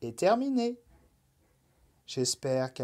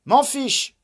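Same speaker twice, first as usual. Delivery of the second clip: put-on high, loud childish defiance; loud pouting refusal; defiant; 11 semitones higher, 9 dB louder than usual. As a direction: snap it back like a sulky child. Rabbit refuses the Night.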